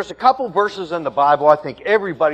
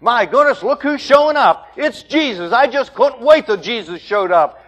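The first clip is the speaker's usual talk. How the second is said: high, loud, gushing mock delight; mock joyful excitement; 6 semitones higher, 4 dB louder than usual. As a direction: ham up demons thrilled to see Jesus. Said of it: "they're not shouting in excitement and in joy".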